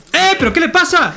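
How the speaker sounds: high-pitched indignant outburst; indignant protest. Pretend. Rider knocked off his horse to a stranger, indignant protest.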